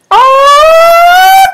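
very high loud surprised squeal. Real, not acted. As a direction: shriek in delight. Squeal at cat.

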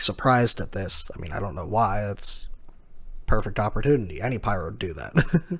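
ordinary delivery

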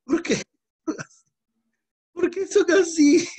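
high, slow, exasperated why; slow despairing high plea. Real, not acted. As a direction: rise up in disbelief at the class. Exasperated teacher scolding class.

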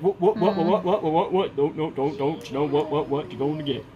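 rapid, repeated "what, what"; mimicking a toy voice. Father mimicking a repetitive toy voice, fast and chirpy. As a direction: rattle off repeated words like a talking toy. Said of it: "It's like".

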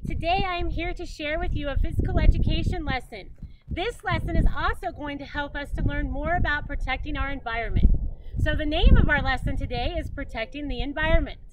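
loud, fast gym teacher greeting; loud, brisk PE teacher intro; energetic lesson opener. Acted. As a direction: pump up the class right away.

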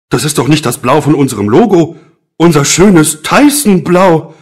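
indignant, loud and rising; exasperated dismay at the wrong blue; upset outburst. Acted. Wrong color sticks delivered.

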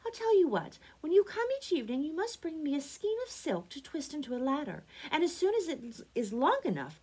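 lilting, high Rapunzel laying out her plan; eager young Rapunzel. A narrator's reading. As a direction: play the girl in a lifted, high voice.

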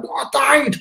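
very high-pitched mock wail; insistent protest. Convinced dead man to his brother, high mock protest. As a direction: squawk it in exaggerated protest.

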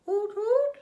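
squeaky loud little hoots; baby bird trying to hoot; high loud hoot. Acted. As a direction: hoot like a tiny owl.

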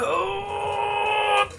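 long, high, held "ohhh" groan; sustained high-pitched stretch noise. Real, not acted. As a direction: let out a drawn-out high groan while stretching. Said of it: "that was a noise".

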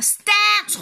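high, loud cry to halt the sleigh; shouting stop. A narrator's reading. Girl shouting at the sleigh driver to stop, loud cry. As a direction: yell it out to make him stop. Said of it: "råber hun".

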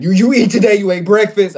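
loud high drill sergeant wake-up; uncle waking him. Retold or imitated. Nephew imitating uncle waking him for school, loud and high. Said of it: "He'd be like".

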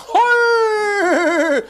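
squeaky high cry; high puppet yelp. Acted. Alfredo puppet bursts in.

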